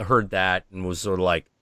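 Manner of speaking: ordinary talk, nothing marked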